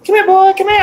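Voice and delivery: very high-pitched mom voice; high mock-motherly call; imitated mom. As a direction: imitate a mom calling her boy over. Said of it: "my mom".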